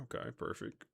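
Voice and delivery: dry sarcastic okay, perfect. Pretend. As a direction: say it deadpan, meaning the opposite. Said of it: "I'm being very sarcastic there".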